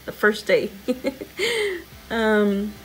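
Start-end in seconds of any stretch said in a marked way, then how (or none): 0.8-2.0 a high falling giggle; sheepish laugh. Real, not acted. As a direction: laugh it off, a little embarrassed. Laughing about quitting roller derby.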